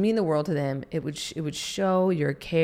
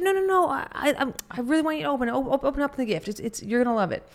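higher, fast, stammering plea; eager gift giver; 9 semitones higher, much faster than usual. Retold or imitated. Giver pleading with friend to open the gift, high eager stammer. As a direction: beg them to open it, tripping over words. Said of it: "You said".

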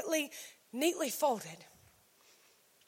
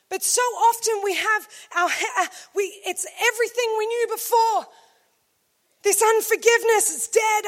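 pleading Mary; 3 semitones higher than usual. Acted. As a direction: beg to keep what is dead. Mary voiced pleading for body.

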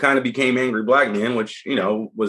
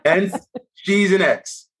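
slow mock echo; 8 semitones higher, much slower than usual. Real, not acted. Echoing partner's joke, laughing.

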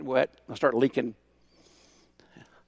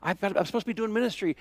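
higher, pleading "really, Lord"; exasperated complaint to God; 6 semitones higher than usual. Retold or imitated. Pastor venting to God on the drive, exasperated. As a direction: vent your frustration upward. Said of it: "And I'm angry".

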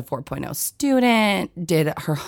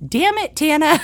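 put-on much higher, squeaky little-kid parroting; toddler copying; 11 semitones higher, 3 dB louder than usual. Three-year-old daughter parroting her dad's curse, in a much higher tiny-kid voice. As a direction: echo it like a three-year-old repeating dad. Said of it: "And she goes".

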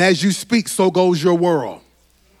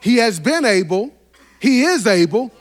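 higher and louder, rising preacher's cadence; full-force preaching; 10 semitones higher, 5 dB louder than usual. Acted. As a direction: preach it with mounting fire to the church.

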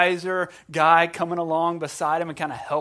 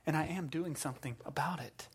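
hushed assurance; 11 dB quieter than usual. Retold or imitated. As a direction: drop to a hush to reassure. God's gentle answer to the complainer.

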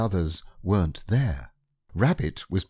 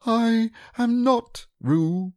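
very high, slow squeaky insistence; a piglet insisting on his name; loud protest; 4 dB louder, much slower than usual. Acted. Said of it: "said Piglet loudly".

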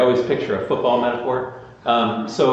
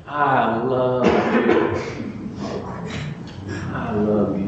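tender; much slower than usual. Retold or imitated. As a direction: say it slowly, lovingly. God voiced lovingly.

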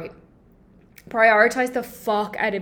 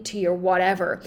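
normal talking voice

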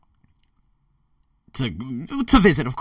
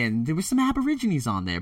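ordinary talk, nothing marked

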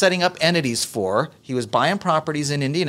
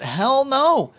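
emphatic hell no; 11 semitones higher than usual. Real, not acted. Host shooting down the stock market, emphatic. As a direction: slam the answer home with a high burst.

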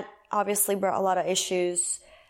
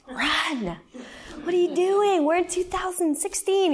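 shrieking in fake alarm, much higher; mock alarmed shout; 10 semitones higher than usual. Scolding homeschool moms she used to pity, mock alarmed shout. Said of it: "I would be like".

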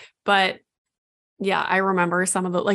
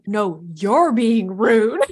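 put-on childish retort; 4 dB louder than usual. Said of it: "I literally feel like a child too".

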